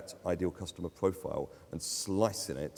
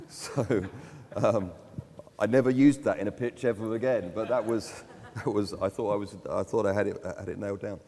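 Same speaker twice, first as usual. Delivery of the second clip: higher and louder, laughing through it; 3 semitones higher, 6 dB louder than usual. Real, not acted. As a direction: talk while still laughing at yourself. Laughing after mayor's punchline.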